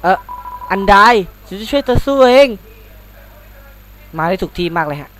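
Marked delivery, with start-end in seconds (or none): 1.5-2.5 higher, louder eager voice; brightly volunteer to fight; eager offer. Acted. Friend offering to help the human fight, eager.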